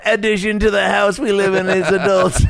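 high cartoon voice breaking into laughter; cartoon character voice. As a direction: pitch it way up and laugh through it. Continues Scooby-Doo cartoon bit, laughing.

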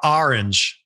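put-on "orange" as "ar-inge" in a Philly accent. Imitating a friend from Philly, "ar-inge" in Philly accent. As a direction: open the first vowel of "orange" to "ar", Philly style. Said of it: "a friend from Philly who says".

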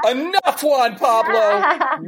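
high-pitched, hammed-up cry of enough; mock-outraged high yelp; exasperated outburst. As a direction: cut him off, voice shooting way up, drawing out the name. Acting out fed-up reaction to Juan Pablo.

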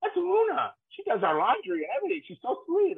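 mock-sweet high voice; fond description. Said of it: "He goes".

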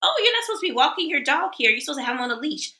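a Karen scolding a dog walker. Retold or imitated. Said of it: "And it's to the extreme".

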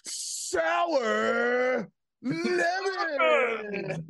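very high, very slow drawn-out shouted name; ring announcer call. Retold or imitated. Friend mocks a ring-announcer intro, long high shouted name. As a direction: stretch the name out like a fight announcer. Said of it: "he's just like".

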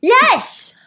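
bursting high cheer of delight; excited yes. Real, not acted. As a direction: shout it out, thrilled. Host cheers a correct answer.

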